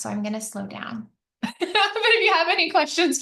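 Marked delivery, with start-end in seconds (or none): 1.4-2.0 short, high-pitched laugh; quick real giggle; self-conscious laugh. Real, not acted. Laughs at own fast talking. 2.0-3.2 laughing. Real, not acted. Laughing after admitting she rushes.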